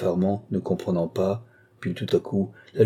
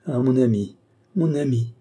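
understated tearful cry, falling tune; higher, lamenting exclamation; 4 semitones higher than usual. A narrator's reading. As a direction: appeal as if tears are starting. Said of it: "s'écria-t-elle en fondant en larmes".

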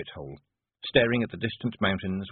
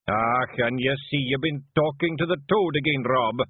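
raised, knowing Scots clansman voice; Scottish clansman; 6 semitones higher, 4 dB louder than usual. Acted. Clansman teasing his chief about long words, mocking. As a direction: needle the chief in broad Scots.

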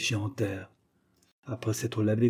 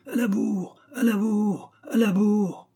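high, loud jeering chant; a horde howling in mockery; howling demons; 11 semitones higher, 5 dB louder, slower than usual. A narrator's reading. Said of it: "encore en hurlant".

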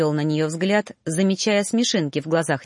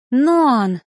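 loud, high pleading cry; pleading call; 5 semitones higher, 6 dB louder than usual. A narrator's reading. Girl rushing to the teacher, pleading cry. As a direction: beg him for help. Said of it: "с мольбой в глазах".

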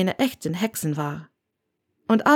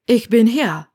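louder, higher answering call; calling back; 4 semitones higher, 5 dB louder than usual. A narrator's reading. Haley answers Granger's call as she approaches, calling out. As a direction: call back to someone, raised voice.

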